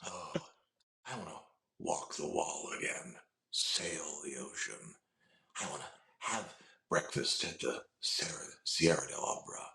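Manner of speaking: a soft, measured crime boss; Raymond Reddington voice. Retold or imitated. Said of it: "like, Raymond Reddington from The Blacklist".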